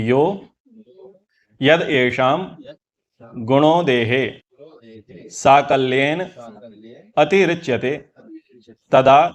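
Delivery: measured call-out for others to repeat; Sanskrit verse recitation. Shown as a demonstration. Teacher giving students the verse to repeat, Sanskrit recitation. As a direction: recite phrase by phrase.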